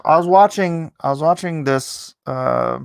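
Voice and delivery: usual speaking voice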